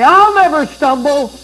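mock confident boast; boastful self-assured quote. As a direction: puff up like a proud man swearing he won't fall. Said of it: "and you say".

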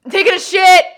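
shouted through a closed door; from another room. Acted. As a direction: yell it from behind a bathroom door. Yelled through bathroom door.

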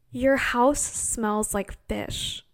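slow, deadpan mock seriousness; dead serious. Aunties telling us gravely, slow deadpan voice. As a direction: drag it out like grave news. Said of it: "in complete seriousness".

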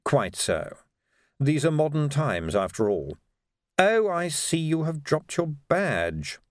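measured, drawn-out aristocratic courtesy; unruffled ruler making small talk; suave patrician, composed. Acted. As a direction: glide through it with dry politeness.

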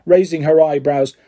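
plain reading voice

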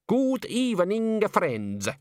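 put-on high, drawn-out Italian-accented greeting; thick Italian-style accent. A narrator's reading. Bather speaks up from the tub, heavily Italian-accented. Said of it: "his voice dripping with accent".

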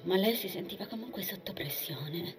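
hushed, tense storytelling; whispered narration. A narrator's reading. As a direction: breathe it out under pressure. (narrazione sussurrata)

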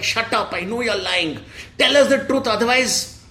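bully the truth out of them; threatening shout. Acted. Constable threatening the prisoners, acted angry shout. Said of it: "one constable was shouting at the prisoners".

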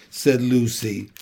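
plain reading voice

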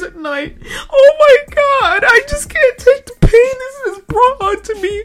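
whiny fake-tearful victim voice; fake crying plea. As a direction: ham up crocodile tears on the stand. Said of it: "crocodile, alligator, goddamn piranha tears".

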